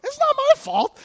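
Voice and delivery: very high, protesting whine; mock defensive whine. Pastor defending himself to the angry mom, very high whine. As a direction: protest your innocence.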